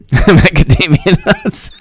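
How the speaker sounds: words breaking into a high laugh. Real, not acted. Reading a nut joke while laughing, high and slow.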